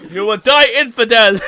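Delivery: mock game enemy. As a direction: ham up a cartoon warrior's threat. Quoting game enemy voice lines.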